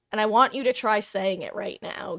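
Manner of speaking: ordinary talk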